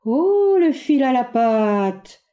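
slow, drawn-out rueful aside. Acted. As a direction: groan it to himself. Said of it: "à part".

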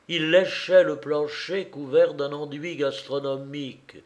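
plain reading voice

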